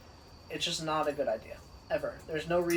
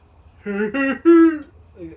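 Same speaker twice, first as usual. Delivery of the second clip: loud laugh; 9 semitones higher, 16 dB louder than usual. Real, not acted. Laughing at Seth Rogen.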